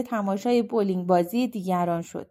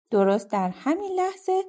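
ordinary delivery

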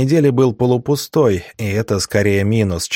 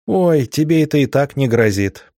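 higher, mildly exasperated dismissal; eye-rolling dismissal; 6 semitones higher than usual. A narrator's reading. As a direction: brush him off with a light eye-roll in the voice. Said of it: "закатила глаза Меха". (пренебрежительно, будто закатывая глаза)

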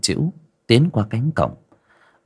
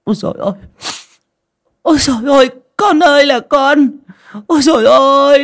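tearful wailing; 8 dB louder than usual. A narrator's reading. Aunt crying out to her dead child relative, loud wailing. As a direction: wail in grief, stretching out the calls. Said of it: "vừa đi vừa mếu máo khóc".

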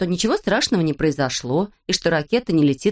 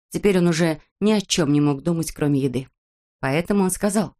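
ordinary delivery

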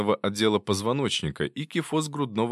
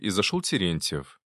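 ordinary delivery

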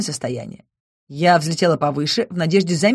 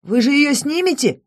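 alarmed; 8 semitones higher than usual. A narrator's reading. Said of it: "переполошился Рейтар".

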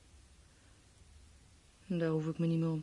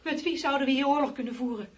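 louder, higher insisting; heated protest; 6 semitones higher, 8 dB louder than usual. Acted. Wife snaps back at husband's propaganda claim, loud protest. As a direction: push back hard at him.

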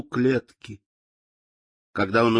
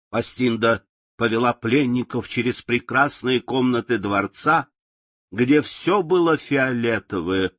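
ordinary delivery

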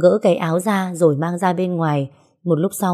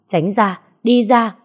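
shouting them off; 3 semitones higher, slower than usual. Acted. Woman shouting at the men at the door. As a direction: drive them back from the door.

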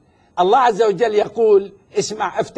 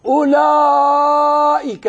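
drawn-out recitation; 6 semitones higher, 4 dB louder, much slower than usual. Real, not acted. Quoting scripture to challenge his opponent, drawn-out recitation. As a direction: hold the word high and very long.

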